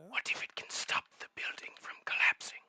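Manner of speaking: hushed secretive whisper. Acted. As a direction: whisper it like a conspirator. Whispering in-character voice.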